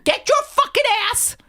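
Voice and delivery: high, loud angry yell; full shout. Retold or imitated. Passenger yelling at the mother on a plane, loud shout. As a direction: show just how loud you yelled it. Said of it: "that loud".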